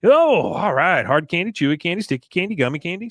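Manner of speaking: hammed-up candy lover; candy enthusiast. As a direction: savor each candy eagerly. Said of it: "She goes".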